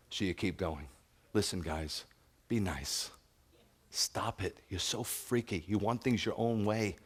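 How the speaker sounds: ordinary talk, nothing marked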